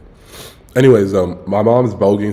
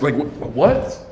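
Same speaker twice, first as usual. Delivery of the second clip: confused surprise; 6 semitones higher, much slower than usual. Real, not acted. Confused at nieces' plan.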